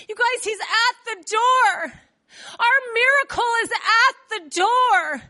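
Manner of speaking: slow, emphatic, excited plea; insistent. Acted. Rhoda tells doubters their miracle is here, insistent. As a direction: draw out each phrase to convince them. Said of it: "Rhoda keeps insisting".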